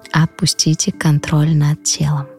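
normal talking voice

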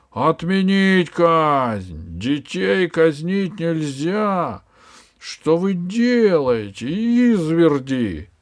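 протяжный, громкий возмущённый крик; взывать к палачам во весь голос; крик толпы. Acted. Возмущённая толпа кричит. (толпа кричит палачам, громко и протяжно)

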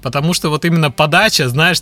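usual speaking voice

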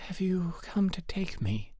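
high, lightly voiced timid girl; gentle trembling voice. A narrator's reading. Poor girl asking King Frost, gentle and trembling. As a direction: ask it small and high, a touch fearful. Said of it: "in a gentle, trembling voice".

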